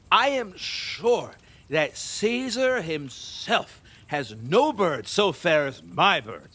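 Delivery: ordinary delivery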